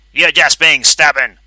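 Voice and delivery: loud, high, slow snarl; angry. A narrator's reading. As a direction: scold him cruelly. Pinky punishes the Brain.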